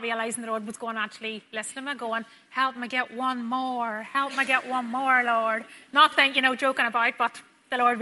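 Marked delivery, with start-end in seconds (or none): 2.5-4.0 drawn-out half-joking plea to God; slow joking plea. Retold or imitated. Nurse jokingly asks the Lord for one more, slow plea. 4.0-5.6 slow, louder mock-imploring plea; pleading joke prayer. Nurse jokingly begs the Lord for another, louder plea.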